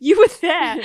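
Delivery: loud, high, insistent quote; insistent mock shout. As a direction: blurt it out with mock certainty. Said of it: "I was like".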